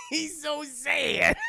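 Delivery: high, loud, drawn-out fake wail; mock crying pity. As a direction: wail in mock sympathy through laughter. Mock pity for heartbroken Jamie, laughing.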